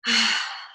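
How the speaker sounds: short demonstrated sigh out; audible sigh. Shown as a demonstration. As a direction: let the breath go in a sigh. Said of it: "Sigh it out".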